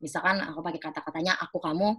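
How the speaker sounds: ordinary delivery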